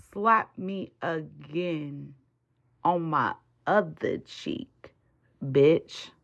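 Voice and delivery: stare him down and drawl it out; slow menacing dare. Acted. Daring an enemy, acted.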